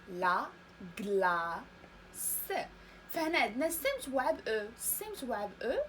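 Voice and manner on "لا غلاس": "لا غلاس" broken apart, low and very slow; syllable-by-syllable model. Shown as a demonstration. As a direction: pause after "لا", draw out "غلاس" to a separate final s. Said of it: "قلنا تنطق س". (نطق "لا غلاس" مقطّعًا ببطء شديد)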